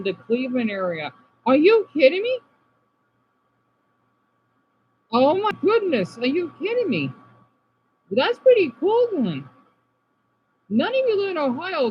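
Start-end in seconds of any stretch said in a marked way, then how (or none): 1.5-7.1 high, excited incredulous exclaim; can't believe it, rising higher; amazed disbelief. Real, not acted. Streamer reacting to meteor news, amazed. 8.1-11.9 high, bright-eyed amazement; thrilled by the news; excited. Real, not acted. Streamer telling chat about the meteor, excited.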